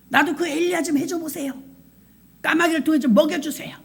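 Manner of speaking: mock demanding. Preacher imitating the mother demanding of God, high mock protest. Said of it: "막 따졌대요".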